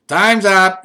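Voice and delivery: loud call that time is over; loud whistle-blowing tent lady; yelling. A narrator's reading. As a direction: yell it out across the tent. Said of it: "she yelled".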